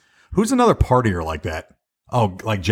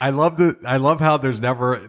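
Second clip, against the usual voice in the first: ordinary talk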